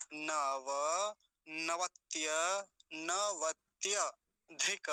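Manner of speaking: very slow, split into pieces; long drawn-out demonstration; broken-down model. Shown as a demonstration. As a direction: stretch every syllable for learners. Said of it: "ऐसा बोलेंगे".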